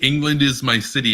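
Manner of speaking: lightly imitated British accent; a touch of mock British rapper. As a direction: quote it with a hint of a British accent. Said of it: "he's got, like, a British accent".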